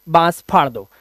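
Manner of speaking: normal talking voice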